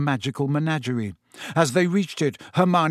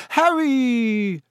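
mock formal; 9 semitones higher, 4 dB louder than usual. A narrator's reading. Fred mocks Percy's formality.

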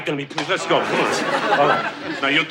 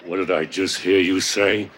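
tough guy act. Pretend. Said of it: "I'm practicing".

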